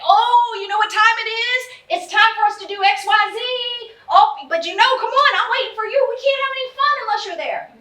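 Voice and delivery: high, loud sing-song coaxing; upbeat parent hype. Shown as a demonstration. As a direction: sell the trip like it's a party. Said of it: "It's like".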